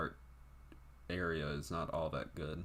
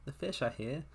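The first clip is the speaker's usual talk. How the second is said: higher put-on British woman's voice; mock British accent, pitched up; 5 semitones higher than usual. Woman telling Peter the fish arrived, mock British accent. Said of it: "I don't know why I made her sound British".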